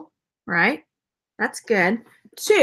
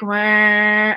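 long droning fan whir; 3 semitones lower than usual. Retold or imitated.

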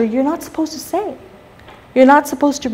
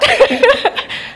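laugh; 12 semitones higher than usual. Real, not acted. Laughing after the joke.

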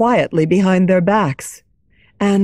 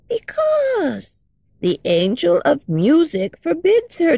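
a lightly voiced kindly old lady, hushed; gentle old lady sharing a secret; old woman; 5 semitones higher than usual.

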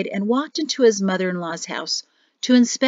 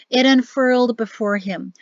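ordinary delivery, nothing marked

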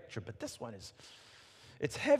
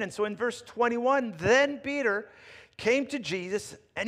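raised, louder reading voice; scripture reading; 6 semitones higher, 6 dB louder than usual. Acted. Preacher reading Peter's question to the congregation, proclaiming. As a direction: proclaim the verse to the room.

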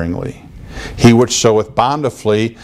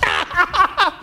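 high giddy cackle; 4 dB quieter than usual. Retold or imitated. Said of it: "I don't want to see anybody dance".

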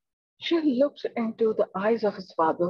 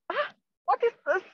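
high, fast frightened squeak; quick panicky what is this; startled lizard; 11 semitones higher, faster than usual. Acted. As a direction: blurt it out in sudden alarm. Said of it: "And he's like".

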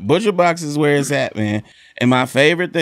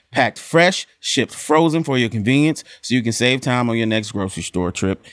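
upbeat sponsor read; lively ad read voice; 4 semitones higher than usual. Acted. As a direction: sell it like a podcast ad. Ad read continues.

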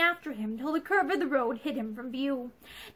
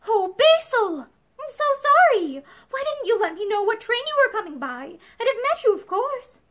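high, effusive apology; flustered apology; 7 semitones higher, 4 dB louder than usual. A narrator's reading. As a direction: gush an apology to your husband. Wife rushes to apologise to husband.

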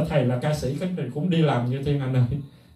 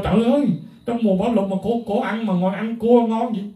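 mock outrage; 7 semitones higher than usual. Mimicking critics of the crab.

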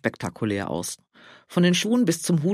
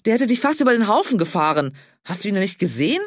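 agitated; 6 semitones higher, 4 dB louder than usual. Acted. Still shaken after near accident.

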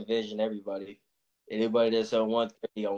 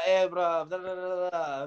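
higher-pitched mock Irish babble; incomprehensible Irish talk; 7 semitones higher than usual. Boxer mimicking guys from Ireland he can't understand, babbled mock Irish. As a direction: imitate Irish speech as a run of nonsense syllables. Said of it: "I can never understand shit they say".

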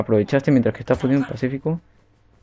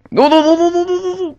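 frantic high-pitched nos; panicked no; 5 dB louder than usual. Real, not acted. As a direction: panic as the ball goes in. Goal about to be scored. (pánico agudo)

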